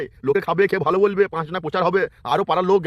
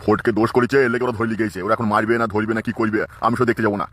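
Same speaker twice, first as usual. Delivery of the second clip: put-on much lower, gruff old man's voice; old father-in-law; 7 semitones lower than usual. Old father-in-law tells his daughter-in-law he won't help his son, in a low old voice.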